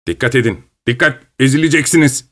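understated called-out warning; mild warning shout. A narrator's reading. A policeman warns people on the bridge, mildly calling out. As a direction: warn urgently, without really yelling. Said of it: "diye bağırdı bir polis".

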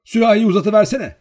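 high, loud command; impatient shouted order. A narrator's reading. Girl demanding the jug from the servant, impatient shout.

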